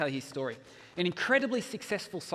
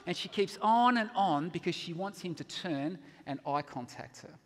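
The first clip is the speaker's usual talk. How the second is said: ordinary talk, nothing marked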